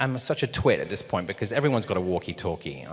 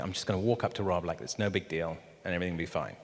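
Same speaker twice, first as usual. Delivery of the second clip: hushed inner thought; 3 semitones lower, 10 dB quieter, faster than usual. Retold or imitated.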